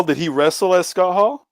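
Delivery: ordinary delivery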